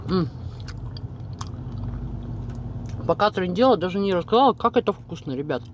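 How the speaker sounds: chewing while speaking; talking between bites; mouth full of food. Real, not acted. Seen 2: bites sausage at -3, cheeks full, jaw working throughout.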